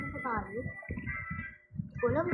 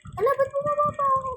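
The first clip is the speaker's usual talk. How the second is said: loud, fast, exasperated; frustrated yell; 11 semitones higher, 11 dB louder, faster than usual. Real, not acted. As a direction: blurt it out in frustration. Fell back down in game.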